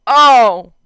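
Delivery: sudden loud bark of realization; loud surprised oh. Acted. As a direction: blurt it out, caught off guard. Bakugo realizing, barked laugh.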